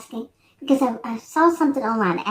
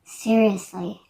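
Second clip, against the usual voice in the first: normal talking voice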